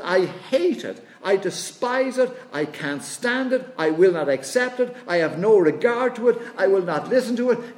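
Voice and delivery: God rejecting empty worship, furious and loud; God's wrath. Acted. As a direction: hammer each refusal home. Voicing God in rising anger.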